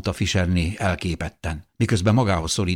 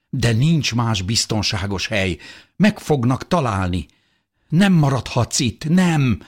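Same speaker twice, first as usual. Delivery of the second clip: higher, rising agitation; desperate protest; 6 semitones higher, 4 dB louder than usual. A narrator's reading. Husband refuses to leave his wife behind, desperate. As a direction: argue back, growing higher and louder.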